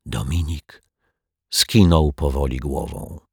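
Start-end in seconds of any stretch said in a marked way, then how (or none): none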